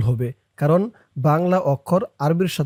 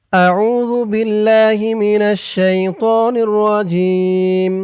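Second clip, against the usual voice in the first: slow, higher chanted tajweed recitation; Quranic recitation; 4 semitones higher, 4 dB louder, much slower than usual. Shown as a demonstration. Teacher recites Ayatul Kursi for students to repeat. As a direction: recite with long drawn vowels.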